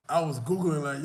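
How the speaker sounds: ordinary talk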